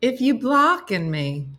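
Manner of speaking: high, loud indignant dog voice; complaining dog. Acted. Dog complains to owner who is blocking her, indignant. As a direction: whine in protest as the dog, pitched well up. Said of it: "She's like".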